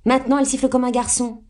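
high, quick tattling; crying out. A narrator's reading. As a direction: exclaim quickly in accusing outrage. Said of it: "s'écria Amy". (s'écrier d'un ton accusateur)

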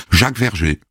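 usual speaking voice

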